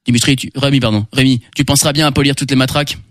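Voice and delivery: low, gruff prison guard; deep guard voice. Acted. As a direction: bark orders like a guard. Prison guard voice, much lower.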